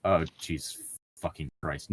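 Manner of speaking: ordinary talk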